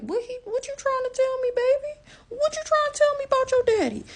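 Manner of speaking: very high, suspicious coaxing; high baby-talk. Retold or imitated. Mother asking baby about daddy, very high suspicious baby-talk.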